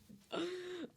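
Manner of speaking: high, sharp gasp of astonishment; dramatic gasp. Retold or imitated. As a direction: gasp as if deeply moved. Retelling an emotional scene climax.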